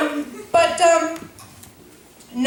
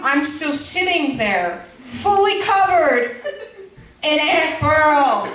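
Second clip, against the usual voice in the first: slow; 2 semitones higher, slower than usual. Real, not acted. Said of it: "slowed deadpan emphasis".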